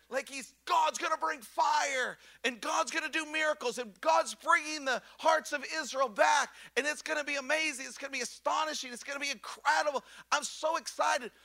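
high, breathless eagerness, words tumbling out; thrilled, racing hope; excited rush. Retold or imitated. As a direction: gush like everything's about to happen. Elijah's eager hopes voiced.

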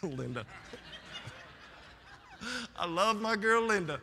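words through a chuckle; laughing affectionately. Real, not acted. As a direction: laugh as you say it. Laughing at Linda.

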